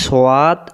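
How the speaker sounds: "সোয়াদ" in two syllables, so-waad; "সোয়াদ" opening out into a wide "waad"; common mispronunciation. Shown as a demonstration. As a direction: say "সোয়াদ" with a W glide into an open aa. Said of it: "অনেক ভুল করি। আমরা অনেকে বলি".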